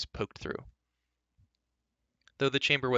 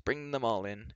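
ordinary delivery

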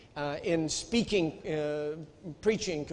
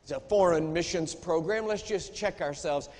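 usual speaking voice

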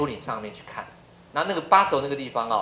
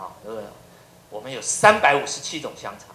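ordinary talk

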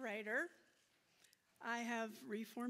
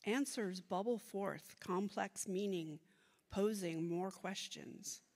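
measured, louder poem recital; poetry reading; 5 dB louder than usual. Acted. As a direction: read it like a poem onstage. Reads her bilingual poem aloud.

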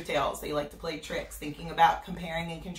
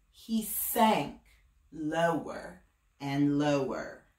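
usual speaking voice

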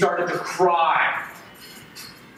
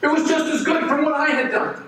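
high, raised and resentful; angry confrontation; 6 semitones higher than usual. Acted. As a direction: demand an answer from God. Cain confronts God.

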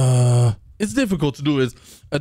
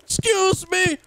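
mock indignant; 12 semitones higher than usual. Mock reply to a nuisance caller.

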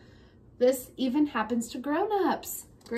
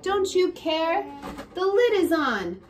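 put-on angry child; 6 semitones higher, 5 dB louder than usual.

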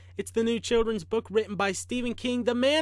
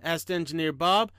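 ordinary delivery, nothing marked

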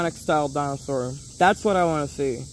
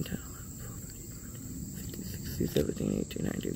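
barely audible, mostly unvoiced count; counting under breath; 16 dB quieter, faster than usual. Real, not acted. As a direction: murmur the numbers to yourself. Counting scales to himself.

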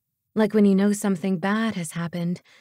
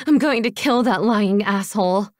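loud furious outburst; shouted threat in rage; explosive anger; 6 dB louder than usual. A narrator's reading. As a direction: blow up at the liar. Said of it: "I exploded".

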